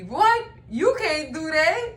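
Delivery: half laughing, act out his outrage; shocked retort. Retold or imitated. Husband balking at what the lawyer can do, mock shocked.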